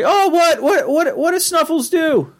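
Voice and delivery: very high, fretful teenage boy impression; Morty. Son asks his father about the dog, high and flustered. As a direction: squeak it out, repeating in a fluster.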